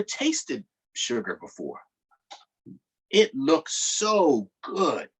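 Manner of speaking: steady read-aloud storytelling voice; story narration. A narrator's reading. Teacher reading a book aloud to children, narrating. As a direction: read the story to the class, measured.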